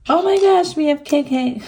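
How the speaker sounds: giddy delight at the pull; excited gasp. Real, not acted. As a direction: burst out over the letters. Spotting the letters.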